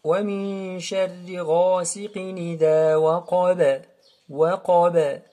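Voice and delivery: sustained "وَمِن", linked verse, bounced "وَقَبَ"; model recitation. Shown as a demonstration. Teacher repeats verse, vibrating end on "وَقَبَ". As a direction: hum the n, then end firmly on "وَقَبَ". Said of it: "je répète".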